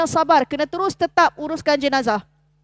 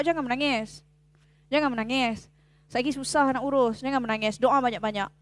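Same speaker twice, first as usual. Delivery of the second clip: soft comforting; 4 semitones lower, 7 dB quieter than usual. Retold or imitated. Telling crying family to stop.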